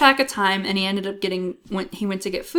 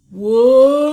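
slow-mo whoa; 4 semitones higher, 8 dB louder than usual. Retold or imitated. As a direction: imitate the slowed-down movie voice. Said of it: "I like how he's like".

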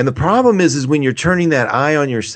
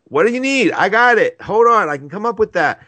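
eager helper voice; 8 semitones higher than usual. Retold or imitated. Voicing her eager Capricorn moon.

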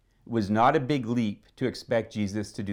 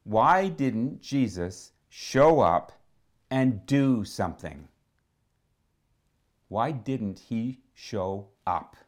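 slow pointed question; slower than usual. Retold or imitated. Preacher repeats the question slowly.

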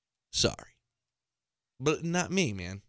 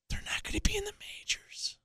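whisper; 12 dB quieter than usual. Real, not acted. Whispered aside to parents.

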